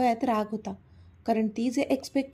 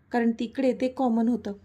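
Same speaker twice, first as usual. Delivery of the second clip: ordinary delivery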